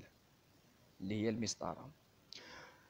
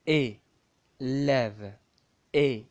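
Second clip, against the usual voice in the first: loud letter demo; 7 dB louder, slower than usual. Shown as a demonstration. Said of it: "هنايا الاي الأولى تبدا".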